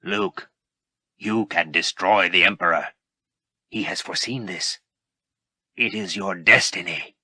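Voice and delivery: lightly voiced, coaxing dark lord; persuasive Vader. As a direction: lure him in gently. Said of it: "Vader continued persuasively".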